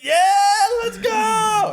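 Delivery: full-voiced, high hype shout; whooping rally cry; retold restaurant yell. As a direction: re-enact the celebratory holler at full blast. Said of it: "We were, like, yelling in the restaurant".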